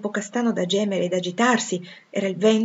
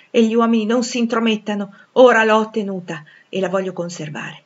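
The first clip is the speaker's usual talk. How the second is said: defiant fervour; 4 semitones higher, 7 dB louder than usual. Acted. Suitor defies interference by others.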